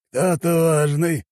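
slow, drawn-out high voice; lazy interest. A narrator's reading. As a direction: ask lazily, stretching the words. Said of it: "лениво поинтересовался тот". (лениво, растягивая слова)

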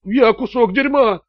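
high, slow, drawn-out whine; whimpering. A narrator's reading. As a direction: whine it out in humiliation. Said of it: "проскулил Раттер". (скулящим голосом)

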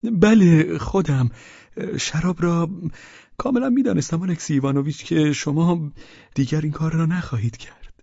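put-on sheepish drunk. A narrator's reading. Pavel admits he sent for wine. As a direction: confess with tipsy sweetness.

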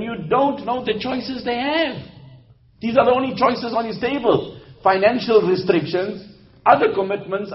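high-pitched, emphatic sermon delivery; impassioned preaching. Real, not acted. Preaches with raised voice.

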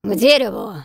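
loud commanding shout; barked order. Acted. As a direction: bark the order at the captive. Hunter orders the priestess dragged.

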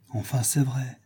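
ordinary delivery, nothing marked

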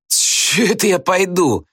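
high, cheeky whine; Gradusov balking. A narrator's reading. Pupil refuses to go home, whining defiantly. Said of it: "кривился Градусов".

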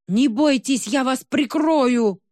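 loud slow booming reassurance. A narrator's reading. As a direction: boom it out protectively. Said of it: "прогудела гора".

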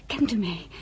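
ordinary talk

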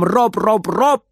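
high, punchy robot chant; staccato high child's yell; loud robot cry. A narrator's reading. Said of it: "с громким криком".

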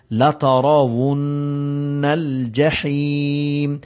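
slow chanted tilawat; melodic recitation. Shown as a demonstration. Teacher reciting the verse for his students. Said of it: "ہا کو صحیح پڑھنا ہے".

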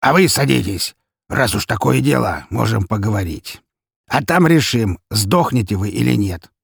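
commanding, with a threat underneath; menacing gang boss. A narrator's reading. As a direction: lay down terms to unwelcome visitors. Boss threatens his visitors.

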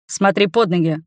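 low, mildly growled order; growled warning. A narrator's reading. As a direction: give a low-pitched command with some growl. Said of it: "рыкнул он". (негромко рыкнув, низким голосом)

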